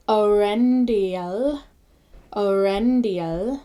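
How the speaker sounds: Orendiel, slowly, e and o separate; slow Orendiel, each vowel its own syllable; Orendiel, two syllables. Shown as a demonstration. Teacher modelling Orendiel slowly, separate vowels. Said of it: "the way that you say the Eo".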